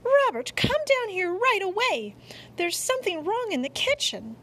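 high, loud cry for help; screaming. A narrator's reading. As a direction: call out urgently, high-pitched. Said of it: "awakened by a scream".

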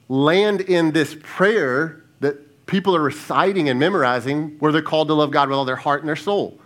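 ordinary delivery

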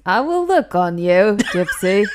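loud mock robotic delivery; Stephen Hawking. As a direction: intone it like a bad computer voice. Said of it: "I sound a bit like Stephen Hawkins".

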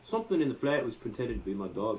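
put-on, unconvincing British accent; bad British accent. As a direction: read it in a badly faked British accent. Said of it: "that doesn't sound like a British accent".

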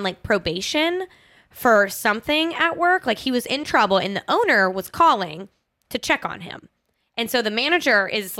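usual speaking voice

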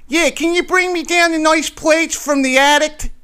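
high, loud mock Northeast "addic" ask; Northeast accent impression. Philly local asking someone to fetch plates, Northeast accent. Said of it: "they'll say".

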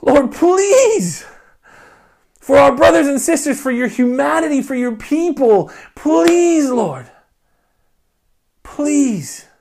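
loud, high, desperate prayer; fervent pleading prayer, voice breaking; crying out to God. Shown as a demonstration. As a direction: plead with God as if on your knees. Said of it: "cry out to Him".

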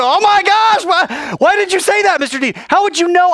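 high, loud shocked questions; freaked out. Acted. Student stunned by the teacher saying the secret word, freaked out. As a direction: panic in amazement.

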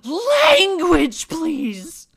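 very high, loud shocked gasp; prissy, shrieking outrage over bad language; gasping scold. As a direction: clutch the pearls with a shrill scold.

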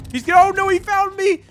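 high, loud panicked cry; terrified shriek; scared horror game streamer. Real, not acted. Endo catches him.

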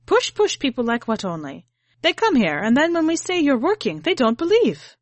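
high, loud, indignant complaint; aggrieved grandma. A narrator's reading. Grandmother to grandchild, loud indignant complaint about police. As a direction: rant about the police, building.

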